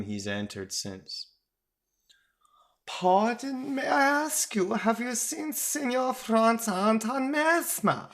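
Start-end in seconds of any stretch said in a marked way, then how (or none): 2.9-8.1 very high, hammed-up Italian accent; polite inquiry in a terrible piping Italian accent; silvery Italian accent. A narrator's reading. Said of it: "A silvery Italian accent".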